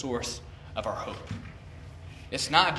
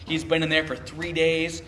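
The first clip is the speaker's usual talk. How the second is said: ordinary talk, nothing marked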